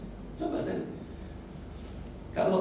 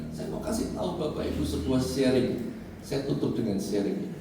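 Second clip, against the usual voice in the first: ordinary delivery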